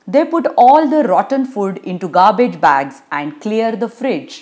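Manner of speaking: usual speaking voice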